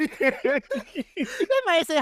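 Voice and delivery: words stretched by laughter, slowed right down; can barely get it out for laughing; helpless giggling; laughing through words. Real, not acted. Laughing at the line.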